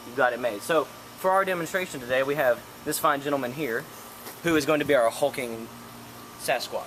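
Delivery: normal talking voice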